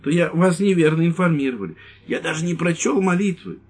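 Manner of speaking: high, pleading whine; pleading old man. Acted. Old priest pleading with the lieutenant, high. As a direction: beg him to believe you. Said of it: "сказал старик умоляюще".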